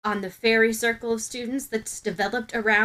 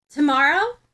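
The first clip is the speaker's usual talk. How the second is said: surprised; 5 semitones higher, 7 dB louder than usual. Acted. Ochako caught by surprise.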